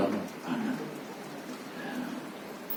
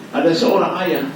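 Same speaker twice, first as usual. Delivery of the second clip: a father; 8 semitones higher than usual. Acted. Father voiced in hadith story.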